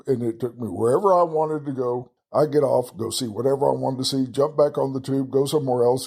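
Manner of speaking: usual speaking voice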